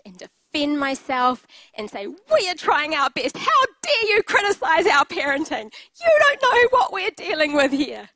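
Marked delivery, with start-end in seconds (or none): 2.1-8.1 higher, louder indignant protest; defensive outburst. Retold or imitated. Mother snapping back at criticism of her parenting, indignant. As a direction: bristle and defend yourself.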